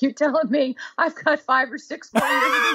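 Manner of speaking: high, laughing incredulous question; laughing disbelief. Retold or imitated. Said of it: "So I'm like".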